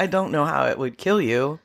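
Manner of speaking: ordinary talk, nothing marked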